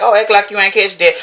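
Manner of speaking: a light, cute put-on voice for a joke among friends; playful teasing quote. Friend teasing the group after a little joke, lightly playful. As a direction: tease your homegirls with a hint of a playful voice. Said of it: "somebody throw a little joke ... be like".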